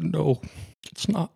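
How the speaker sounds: getting emotional. Real, not acted. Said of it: "I did promise I'd get emotional".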